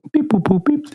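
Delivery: high, mock robot beeping; robot beeps. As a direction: chirp like a little robot. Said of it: "do the thing".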